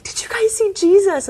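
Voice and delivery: eager, high-pitched question; excited child. Retold or imitated. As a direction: pipe up high like an excited kid. Young child calling to parents in retelling.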